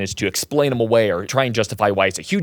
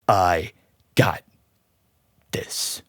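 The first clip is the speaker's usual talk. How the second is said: whispered I got this; cool line; 3 semitones lower, much slower than usual. Retold or imitated. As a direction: breathe it out as a cheesy hero line. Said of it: "cringy to watch him just suddenly turn".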